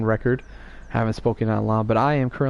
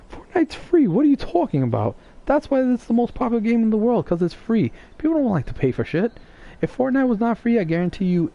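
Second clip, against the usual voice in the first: exasperated; 10 semitones higher than usual. Real, not acted. Exasperated arguing with friend.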